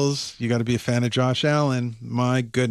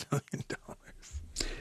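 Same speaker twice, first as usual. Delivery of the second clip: playful whisper; 17 dB quieter, slower than usual. Pretend. Joking about winning the lotto.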